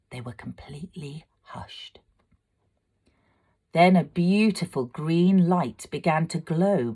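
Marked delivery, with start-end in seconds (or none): 0.1-2.0 breathy hush, barely voiced; whisper. A narrator's reading. Narrator hushing along with the silent audience, whispered. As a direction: drop to a whisper as the crowd falls silent.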